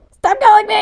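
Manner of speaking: high, loud outburst at an interruption; exasperated yell. Real, not acted. Vlogger to parents phoning mid-recording, exasperated yell. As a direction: yell it in exasperation, pitch shooting up.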